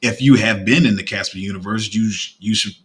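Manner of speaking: ordinary delivery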